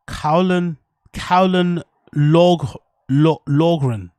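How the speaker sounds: slow, careful first try at Cowlan Log-, Lo-, Logren, breaking it into pieces; sounding out a name. Shown as a demonstration. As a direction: stumble slowly through Cowlan Logren syllable by syllable. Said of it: "Is that how you pronounce his name?".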